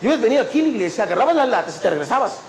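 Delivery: rapid-fire imitated reproach; hurried scolding. Supervisor telling volunteer what he should have done, hurried scolding. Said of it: "con esas prisas me habló".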